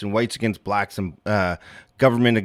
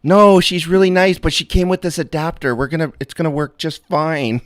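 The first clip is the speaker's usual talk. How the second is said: higher, louder mock son; 8 semitones higher, 4 dB louder than usual. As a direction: play the son reassuring dad, higher. Acting son reply.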